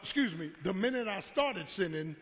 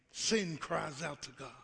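ordinary talk, nothing marked

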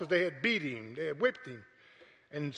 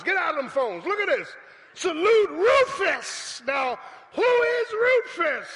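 loud, high preaching shouts; full-force preaching; 7 semitones higher, 6 dB louder than usual. Acted. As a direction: call the congregation out, climbing in pitch.

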